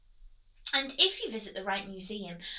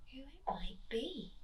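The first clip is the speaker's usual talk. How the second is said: hushed whisper; 9 dB quieter than usual. A narrator's reading. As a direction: whisper it in the dark. Narrator whispers discovery.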